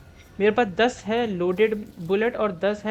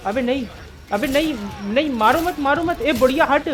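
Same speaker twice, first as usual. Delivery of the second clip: alarmed, higher and louder pleading; panicked shouting; 4 semitones higher, 4 dB louder than usual. Real, not acted. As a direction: yell frantically to fend off an attacker. Game zombies attack him, he pleads. (घबराकर चिल्लाते हुए)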